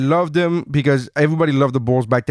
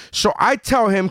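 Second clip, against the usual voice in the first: ordinary delivery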